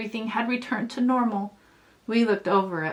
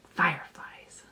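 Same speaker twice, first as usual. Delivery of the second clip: whispered softly, full of wonder; hushed whisper; 5 semitones lower, 6 dB quieter, slower than usual. Acted. Narrator whispering to a child about the fireflies. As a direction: breathe the word like a secret. Whispered reveal at story's end.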